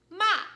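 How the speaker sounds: "ma" high, loud and falling sharply; scolding, forceful drop on "ma"; fourth tone. Shown as a demonstration. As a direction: snap "ma" down like a scold. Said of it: "it actually sounds like a scold".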